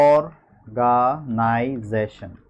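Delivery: অর্গানাইজেশন very slowly, broken into five separate syllables. Shown as a demonstration. Teacher showing students the syllables in a word, অর্গানাইজেশন broken slowly into five parts. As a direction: stretch out each syllable of অর্গানাইজেশন, with gaps between. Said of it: "পাঁচটা খণ্ডে আমি শব্দটা উচ্চারণ করেছি".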